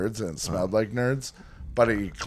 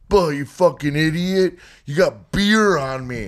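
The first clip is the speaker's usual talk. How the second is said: louder mock yell at a stranger; a retold jerk snapping over spilled beer; angry guy at the fest; 6 semitones higher, 4 dB louder than usual. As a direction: bark it like the drunk who got bumped. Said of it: "He's like".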